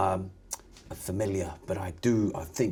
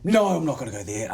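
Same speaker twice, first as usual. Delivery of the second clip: loud, higher-pitched acted refusal; emphatic refusal; 5 semitones higher, 8 dB louder than usual. Player's character recoiling from a horse flashback, loud refusal. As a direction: burst out with a firm no. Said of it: "I'm like".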